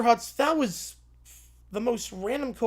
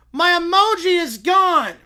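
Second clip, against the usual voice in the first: loud, high and slow, in a put-on voice; dismayed cry; 7 semitones higher, 9 dB louder than usual. Blue-haired lawyer lamenting his lost emoji, loud and slow. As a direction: wail the loss.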